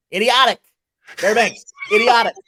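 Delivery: high, loud mocking chant of idiotic; teasing teammates jeering his word. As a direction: teammates ribbing him loudly for a phrase, mock jeer. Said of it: "they would just be like".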